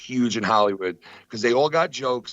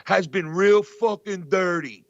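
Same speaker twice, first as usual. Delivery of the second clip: imitating Dice; 6 semitones higher, slower than usual. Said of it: "he goes".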